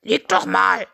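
put-on eager character voice; eager rooster. A narrator's reading. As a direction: urge it out briskly as a keen rooster. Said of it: "sagte Henry eifrig".

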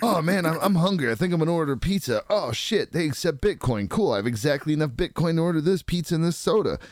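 a pleased guy ordering pizza with Bitcoin; hungry stoner Greg. Retold or imitated. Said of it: "It'd be like".

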